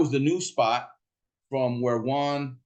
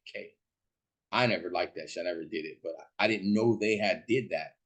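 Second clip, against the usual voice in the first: ordinary talk, nothing marked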